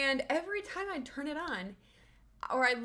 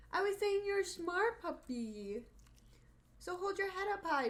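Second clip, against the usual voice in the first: much higher cooing pet voice; 8 semitones higher than usual. Real, not acted. Owner lifting her sad puppy's spirits, high baby talk.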